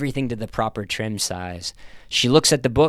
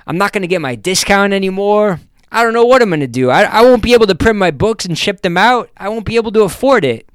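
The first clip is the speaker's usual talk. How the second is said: high, loud, fast upset rush; panicking over losing her job; 10 semitones higher, 8 dB louder, faster than usual. A narrator's reading. Kathy Jane telling the narrator she is fired, loud and fast.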